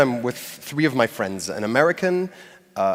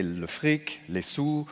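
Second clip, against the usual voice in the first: French slang; 4 semitones higher, 5 dB quieter, much slower than usual. Shown as a demonstration. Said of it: "people say".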